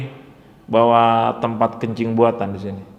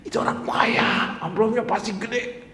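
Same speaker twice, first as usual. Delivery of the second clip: whispered tease; 8 semitones higher, 6 dB quieter than usual. Retold or imitated. Mock reply, whispered and higher.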